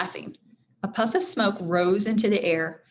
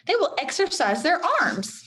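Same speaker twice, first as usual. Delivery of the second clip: Priscilla's voice; 9 semitones higher than usual. A narrator's reading. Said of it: "said Priscilla".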